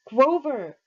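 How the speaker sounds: loud, high call of his name; two friends shouting at their friend to wake him up. Acted. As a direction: yell across the room to snap him out. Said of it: "we both shouted".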